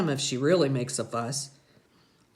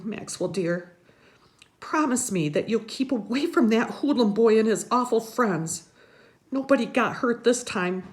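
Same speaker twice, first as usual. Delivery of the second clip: put-on higher, fretting old lady; grandma's voice; 8 semitones higher than usual. A narrator's reading. Grandma warning the boy about the hoodlum, high and fretting. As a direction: plead like a worried grandmother. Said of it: "Graham says".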